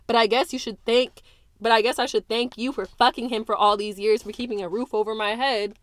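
loud accusing tirade; bitter fury. Acted. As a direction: hurl every word at her. Daughter lashes back at mother.

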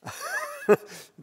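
a quick amused laugh; short laugh. Real, not acted. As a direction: laugh at the joke you just made. Preacher laughs at own quip.

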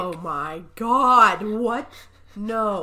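slow, loud "oh my God"; real appalled disbelief. Real, not acted. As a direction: draw it out in horrified disbelief. Shown the awful ghost picture.

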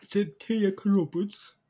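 loud, high, slow British parody; mock British accent. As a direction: echo it in drawn-out, hammed-up British.

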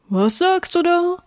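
a small puzzled rock creature; squeaky lump asking, puzzled. Acted. Rock lump answers the sun.

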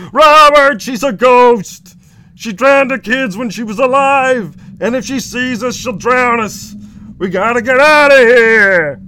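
high, loud, panicking sitcom brother; Raymond. Acted. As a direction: warn with hammed-up terror, dragging words out. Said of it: "pull off that Everybody Loves Raymond".

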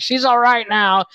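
high, slow squeaky relief; high doll voice. Retold or imitated.

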